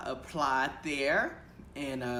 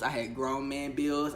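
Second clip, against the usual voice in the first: ordinary talk, nothing marked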